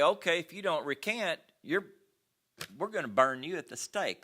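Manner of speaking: lightly voiced emperor's threat to burn him; mild mock threat from the emperor; threatening emperor.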